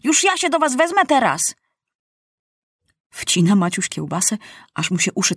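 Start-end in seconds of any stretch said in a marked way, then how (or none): 0.0-1.6 high, indignant warning; high-pitched angry vow; threatening. A narrator's reading. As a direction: threaten them like a bossy boy king. Maciuś threatens his lackeys.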